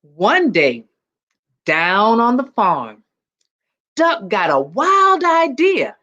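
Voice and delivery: put-on lively read-aloud for children; storybook reading. A narrator's reading. Principal reading a picture book to students, lively storytelling. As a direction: tell it like a picture book to a class.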